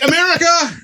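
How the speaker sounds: high triumphant shout; hammed-up cheer for America; mock cry. Son in the doorway shouting a mock cheer.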